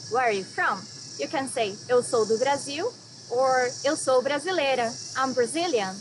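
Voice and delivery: ordinary talk, nothing marked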